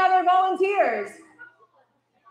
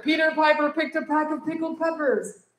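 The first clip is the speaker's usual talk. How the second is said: faster. Shown as a demonstration. Teacher showing the class a faster run. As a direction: rattle the tongue twister off quicker. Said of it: "Say it faster".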